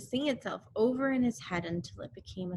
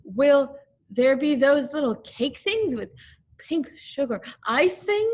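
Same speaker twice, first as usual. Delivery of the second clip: higher, louder hopeful bear voice; hopeful Pooh; 5 semitones higher, 5 dB louder than usual. Acted. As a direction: ask eagerly about cakes. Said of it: "he said".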